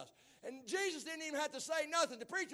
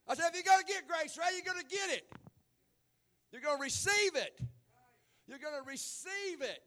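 higher, louder, fast preaching; preaching climax; 5 semitones higher, 6 dB louder than usual. Acted. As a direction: drive it home at full force.

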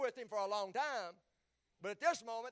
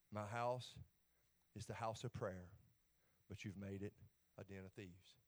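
much softer and lower, fading away; almost breathe the words out; hushed low reading; 11 semitones lower, 8 dB quieter than usual. Acted. Reads temple rebuke hushed.

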